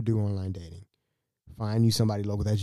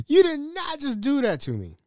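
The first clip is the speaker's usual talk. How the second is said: very high-pitched comic outrage; mock indignation; 3 dB louder than usual. Man left alone in a room, mock indignant. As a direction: squeal it in playful disbelief.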